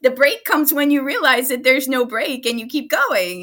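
high, laughing mock self-talk. Therapist laughing at her own inner voice, high and amused.

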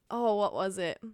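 ordinary talk

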